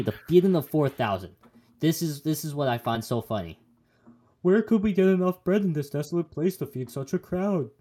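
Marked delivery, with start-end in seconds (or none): 4.4-7.7 high, loud, hammed-up helpless question; mock bewilderment. Disciples asking Jesus about bread, mock bewildered. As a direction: play up the disciples' cluelessness.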